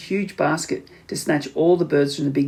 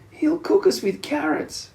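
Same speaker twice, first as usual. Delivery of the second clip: crying; 11 semitones higher than usual. A narrator's reading. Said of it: "cried the third".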